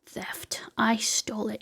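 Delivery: hushed, breathy whisper; whispered confession. Real, not acted. Streamer confessing the theft to chat in a whisper. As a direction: whisper it like a guilty secret.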